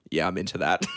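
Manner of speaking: low, understated murmur of approval; low-pitched, deadpan aside; friend's appreciative aside. Retold or imitated. Friend's aside acted out in story.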